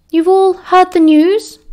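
high, thin, slow weary voice; a worn-out teacher breaking bad news; strained, tired professor. A narrator's reading. McGonagall breaking bad news to students, high thin strain. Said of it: "her voice thinner than usual, strained and tired".

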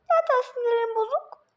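high squeaky mimicking of the grumpy line; mocking whiny repeat. Teasing a friend by echoing him in a high mocking whine. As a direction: parrot it back in a high whiny mock voice. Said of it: "Tekrar etme beni".

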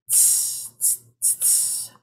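soft unvoiced tss tss tss like a feathered cymbal; cymbal hiss. Shown as a demonstration. Poet imitating a drummer's cymbal for the crowd, soft hiss. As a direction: whisper the hi-hat rhythm. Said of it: "My turn".

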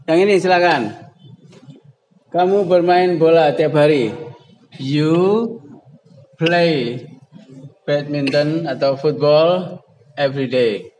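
normal talking voice

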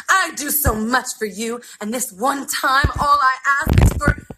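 hurt anger. Acted. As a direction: lay on the guilt, slow and rising. Mother's fury continues.

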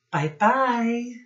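ordinary delivery

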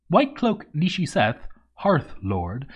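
ordinary delivery

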